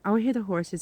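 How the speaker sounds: ordinary talk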